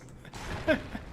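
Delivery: unvoiced shaky laugh after a fright; breathy nervous laugh. Real, not acted. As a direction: laugh off the scare under your breath. Laugh after jump scare.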